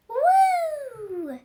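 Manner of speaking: very high, loud shaky howl; trembling pup howls as high as he can; squeaky howl. Acted. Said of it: "howled higher than ever".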